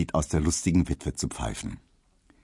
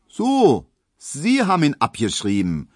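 sudden high, loud surprised "So"; interested postman; 9 semitones higher, 5 dB louder than usual. A narrator's reading. Postman perks up at the news, high and loud surprise. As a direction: perk up with keen interest. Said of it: "fragte Herr Schulze mit einem Interesse".

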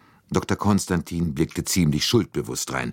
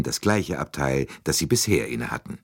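ordinary delivery, nothing marked

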